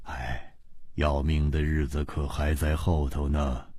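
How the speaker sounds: deep, quiet, dry taunt; amused teasing. A narrator's reading. Ji Bingyan teasing his scared friend, low quiet amusement. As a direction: tease him low and soft. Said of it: "季冰燕笑着说".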